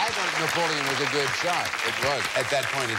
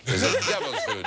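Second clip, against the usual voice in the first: a laughing, disbelieving check of the answer; laughing question. Real, not acted. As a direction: squeak it out high through a laugh, amused. Host reacts to a wrong guess.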